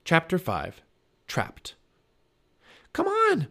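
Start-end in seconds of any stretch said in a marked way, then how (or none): 2.9-3.5 put-on high-pitched girl urging someone along; urgent, very high call; urgent cry. A narrator's reading. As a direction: cry out high to get them moving. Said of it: "cried Annie".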